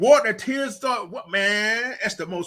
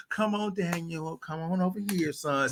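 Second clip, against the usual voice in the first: coddling coach; 7 dB quieter than usual. Retold or imitated. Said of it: "Not this old".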